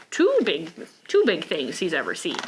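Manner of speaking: ordinary delivery, nothing marked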